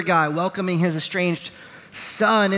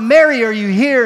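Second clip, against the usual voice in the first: loud, higher call across the house; 5 semitones higher, 9 dB louder than usual. Retold or imitated. Husband bursting in, calling for his wife loudly. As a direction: call out for her, alarmed.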